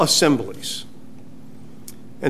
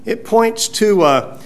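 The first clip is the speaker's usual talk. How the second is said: normal talking voice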